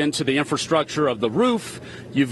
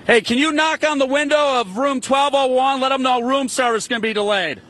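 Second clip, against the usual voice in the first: calling out; 10 semitones higher than usual. Acted. Reporter calling toward hotel window.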